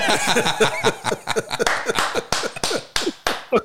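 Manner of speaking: silent wheezing laugh at his own joke; breathy laugh. Real, not acted. Teller laughing at his own golf joke punchline. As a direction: laugh it off, airy and high.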